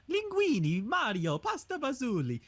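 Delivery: high, singsong cartoon Italian accent. Shown as a demonstration. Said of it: "two very distinct Italian-American stereotype".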